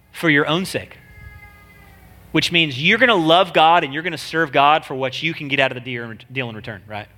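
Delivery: normal talking voice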